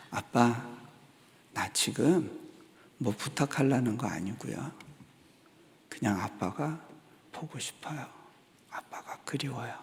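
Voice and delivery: fading from soft to a whisper; tender confession. Retold or imitated. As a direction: confess it quietly, letting the voice drop away. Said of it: "그리고 이렇게 고백했어요". (속삭이듯 잦아드는 고백)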